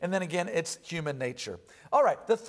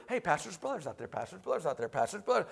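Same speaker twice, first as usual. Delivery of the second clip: softer hushed murmur spreading; whispering congregation; 3 semitones lower, 7 dB quieter, faster than usual. Retold or imitated. The congregation whispering to each other during the sermon. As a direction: murmur it like the whole room is passing it on. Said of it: "the whole congregation's whispering".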